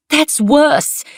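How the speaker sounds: sharp high loud snap; angry retort. A narrator's reading. Angry woman snapping at her coworker, high and loud. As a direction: bite back, furious. Heated argument with Niels.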